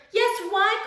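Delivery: high calling voice. A narrator's reading. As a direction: call up from the ground. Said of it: "called the dingoes".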